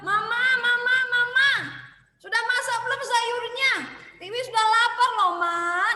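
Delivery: high, loud sing-song yell of Mama; child yelling for mom. Acted. Hungry child yelling at mom from her room, high and loud. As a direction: call out impatiently from the bedroom. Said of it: "Tiwinya teriak lagi".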